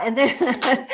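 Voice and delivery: real laugh at her own habit; giggling mid-sentence. Real, not acted.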